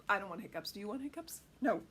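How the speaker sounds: ordinary delivery, nothing marked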